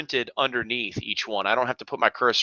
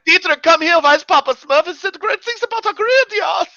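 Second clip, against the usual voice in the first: very high, loud mock German; hammed-up German fan, squeaky pitched; German accent; 4 dB louder than usual. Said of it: "we actually get emails like".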